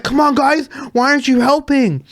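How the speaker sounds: high, exasperated mock complaint; imitated pissed-off. European DJ scolds the guys in the van, imitated anger. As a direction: act out an indignant demand for help. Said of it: "He was fucking pissed".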